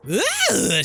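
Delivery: loud, high-pitched "ugh" of effort; effortful grunt. Acted. Player hurls a bottle at the mirror, loud effortful grunt. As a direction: throw your whole voice into a grunt.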